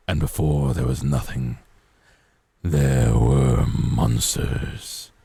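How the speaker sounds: deep ancient undead king voice; the Lich. Retold or imitated. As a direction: drop low like a rasping lich. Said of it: "this sounds like, uh, the Lich from Adventure Time".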